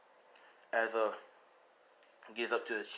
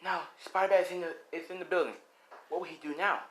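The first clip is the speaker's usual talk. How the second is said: quick, higher reporter voice; news anchor tracking the hero, quick and higher; 6 semitones higher, faster than usual. Acted. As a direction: report it breathlessly on live TV. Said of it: "As, you know, like".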